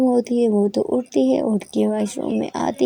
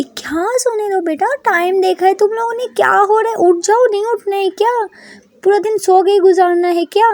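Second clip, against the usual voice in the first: scolding aunt; 8 semitones higher, 4 dB louder than usual. A narrator's reading. Aunt scolds sleeping girls.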